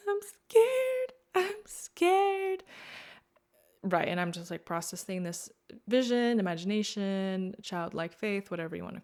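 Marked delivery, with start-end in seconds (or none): 0.1-2.6 very high, loud mock wail; hammed-up tearful squeal; imitated scared sobbing. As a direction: act out a sobbing, frightened inner child.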